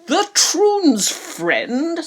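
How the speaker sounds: high, slow, drawn-out disbelief; astonished question. A narrator's reading. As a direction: let the king's jaw drop on it. King surprised question.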